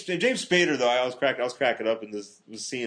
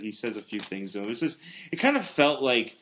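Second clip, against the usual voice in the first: ordinary talk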